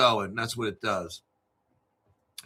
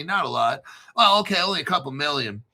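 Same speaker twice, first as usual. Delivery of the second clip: normal talking voice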